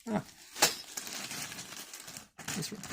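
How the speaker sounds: ordinary talk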